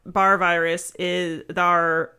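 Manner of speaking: slow pirate drawl on Barr; pirate accent. Acted. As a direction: lean into the pirate R. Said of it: "Speaking pirate".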